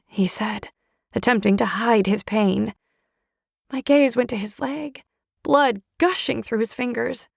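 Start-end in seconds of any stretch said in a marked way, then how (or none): none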